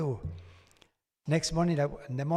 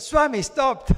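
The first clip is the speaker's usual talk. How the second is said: excited call; 10 semitones higher, 9 dB louder, slower than usual. Retold or imitated. Excitedly telling Swami watch stopped.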